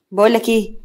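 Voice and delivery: ordinary delivery, nothing marked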